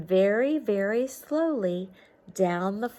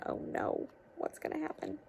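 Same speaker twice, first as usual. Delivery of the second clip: worried whisper; 7 semitones higher, 10 dB quieter than usual. Acted. Teacher worried aside to kids.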